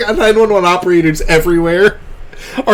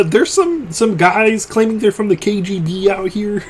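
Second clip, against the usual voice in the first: high-pitched, hesitant puppet frog voice; Kermit the Frog; 5 semitones higher, 4 dB quieter than usual. Pretend. Frog confiding fear of the KGB, Kermit voice. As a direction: put on a nervous cartoon frog. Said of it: "eases into Kermit the Frog".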